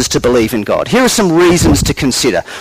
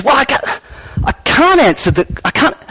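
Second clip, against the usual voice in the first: flustered stammer; 7 semitones higher than usual. Acted. Fred flustered, cannot answer.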